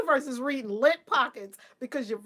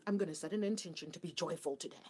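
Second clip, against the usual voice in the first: mock grown-up; 8 semitones lower, 11 dB quieter, faster than usual. Mocking grown-ups' morning intentions.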